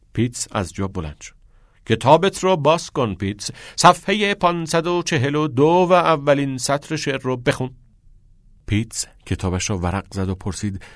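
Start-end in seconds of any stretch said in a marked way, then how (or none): 1.9-7.7 teacher giving a brisk order, raised pitch; teacher's classroom voice; commanding teacher. Acted. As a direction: instruct the pupil, higher and louder.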